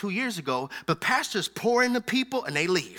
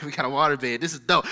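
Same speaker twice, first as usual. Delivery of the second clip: laughing quote; 6 semitones lower, faster than usual. Retold or imitated.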